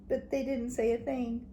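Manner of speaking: plain reading voice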